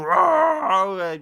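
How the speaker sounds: loud, high mock monster roar; demon roar. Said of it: "He goes".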